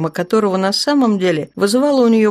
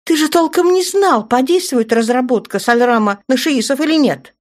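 agitated; 6 semitones higher than usual. A narrator's reading. Наташа возмущённо спрашивает.

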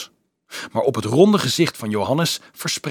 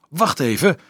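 higher, indignant interruption; angry; 7 semitones higher, 4 dB louder than usual. A narrator's reading. Nicolaas objecting to the hunting plan, angry. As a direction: cut in crossly. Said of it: "riep Nicolaas boos".

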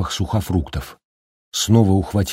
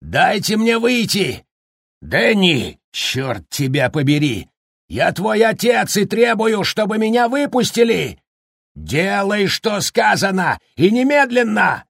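berserk rage; 12 semitones higher than usual. A narrator's reading. Jack raging at the door.

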